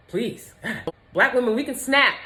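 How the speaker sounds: ordinary delivery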